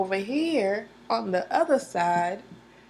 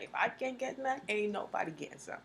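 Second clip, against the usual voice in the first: softer, petty sulking; salty grumble; 9 dB quieter than usual. Retold or imitated. Jealous Grim Reaper venting, soft petty grumble. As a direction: mutter it spitefully. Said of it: "he was like".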